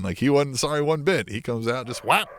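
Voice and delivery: ordinary delivery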